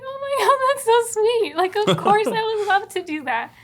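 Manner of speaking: high, loud gushing oh my God; thrilled girlfriend gushing at the sweet gesture; gushing delight. Retold or imitated. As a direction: gush with delight, voice jumping up. Recalling his sweet church idea.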